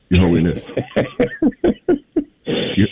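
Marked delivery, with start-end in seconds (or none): 2.8-2.9 laughing. Real, not acted. Host breaks up laughing mid-question.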